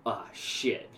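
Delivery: under the breath, a mock realizing "shit"; half-whispered dismay as the boat goes; muttered curse. Girlfriend realizing, retold.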